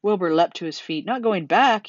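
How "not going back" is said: higher, louder cry of disbelief; alarmed cry. A narrator's reading. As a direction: cry out in startled protest. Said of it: "he cried".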